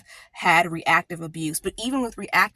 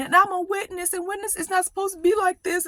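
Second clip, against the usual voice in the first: high, strained retelling of her own crying plea; high-pitched tearful recollection; tearful pleading; 10 semitones higher than usual. Retold or imitated. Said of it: "I'm sitting up here crying".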